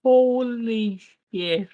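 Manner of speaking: slow, drawn-out holy shit; mock shock. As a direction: let it sink in, stunned. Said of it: "but I'm like".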